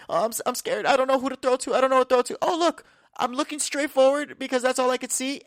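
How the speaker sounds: high-pitched mock-frightened quarterback; hammed-up panic, stammer into it and pitch it high; Zach Wilson scared. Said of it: "it's my Zach Wilson being scared impression".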